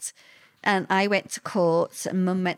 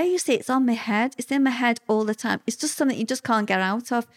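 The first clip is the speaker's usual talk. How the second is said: ordinary talk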